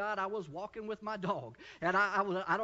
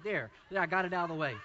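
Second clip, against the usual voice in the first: ordinary delivery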